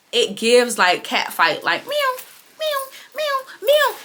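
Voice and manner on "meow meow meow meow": high squeaky meows; mock cat. Vlogger mocks baddies' catfight with high cat meows. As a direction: mew like a catfight.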